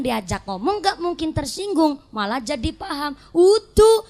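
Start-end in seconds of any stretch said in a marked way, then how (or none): 3.3-4.0 unoffended neighbor. Acted. Replayed unoffended neighbor's denial.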